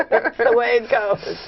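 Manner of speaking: high, laughing shrug of a line. Real, not acted. Actress shrugging off lost film roles, laughing. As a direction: laugh it away lightly.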